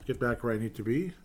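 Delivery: ordinary talk, nothing marked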